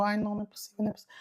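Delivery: a hushed, fading moan of panic; dying away to almost nothing in dread; despairing under breath. Retold or imitated. Trapped in stall, panicking quietly.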